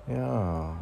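ordinary talk, nothing marked

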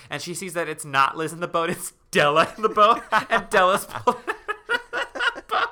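loud, high, breaking into laughter; telling a creepy twist, rising into laughter; laughing reveal. Real, not acted.

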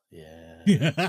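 breathy whisper after a sigh. Real, not acted. Sighs then whispers.

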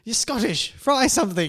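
high, loud comic outburst with laughter; mock Scottish quote. Fan recalling the Doctor meeting Amy Pond, laughing imitation. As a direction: shout it playfully, breaking into laughter. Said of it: "when he first met Amy Pond".

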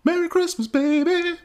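high, put-on crooner's greeting; silly high voice. Jokey sign-off.

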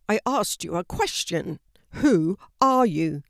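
loud, stern repeated demand. A narrator's reading. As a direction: press him hard for an answer. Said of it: "demanded Dad".

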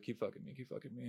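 very soft, under-the-breath quote; barely voiced mock plea; hushed imitation. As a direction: imitate her urging in a tiny, quiet voice. Acts out woman urging him on.

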